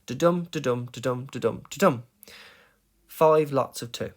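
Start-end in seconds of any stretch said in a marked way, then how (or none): 0.1-2.0 "Da-dum" run, each DUM stressed; rhythm demo. Shown as a demonstration. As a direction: tap out the "da-dum" beat, weak-STRONG. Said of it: "that's the main gist of it".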